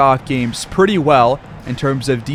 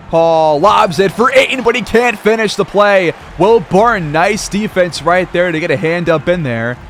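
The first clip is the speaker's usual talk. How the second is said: higher-pitched, animated basketball call; play-by-play; 4 semitones higher than usual. Acted. Announcer calling a lob and defensive stop, excited play-by-play. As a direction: call the play with a burst of energy.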